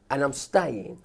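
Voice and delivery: ordinary talk, nothing marked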